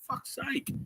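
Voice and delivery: whiny sigh. Retold or imitated. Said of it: "I'm like".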